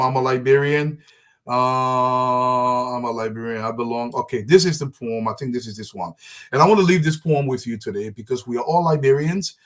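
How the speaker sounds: usual speaking voice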